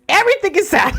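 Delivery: high, hammed-up lament tipping into laughter; mock wail. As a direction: wail it out, half laughing at yourself. Mock wail at own sadness, laughs.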